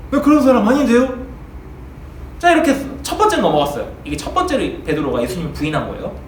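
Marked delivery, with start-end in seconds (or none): 0.1-1.2 insistent denial. Acted. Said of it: "베드로가 뭐라고 했게 해요?".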